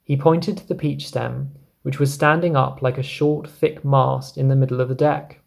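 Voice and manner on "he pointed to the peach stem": plain reading voice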